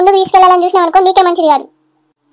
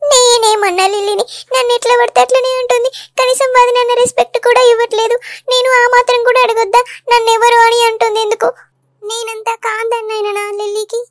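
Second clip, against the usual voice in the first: tearful complaint; 4 semitones higher than usual. Acted. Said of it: "ఎందుకు ఏడుస్తున్నావు మల్లిక". (ఏడుస్తూ బాధగా గోడు వెళ్లబోసుకోవడం)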